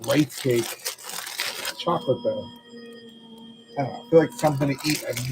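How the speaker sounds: talking while crunching a snack; crunching chips. Real, not acted. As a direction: mouth full, chewing between words. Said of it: "excuse the crunching".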